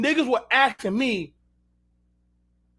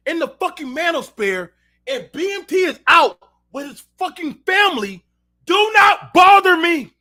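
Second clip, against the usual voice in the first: shouted rant; 8 semitones higher, 7 dB louder than usual. Real, not acted. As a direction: yell the warning, hammering each word. Ranting warning to fans.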